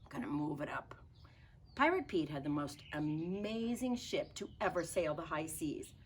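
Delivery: plain reading voice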